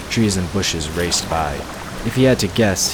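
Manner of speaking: normal talking voice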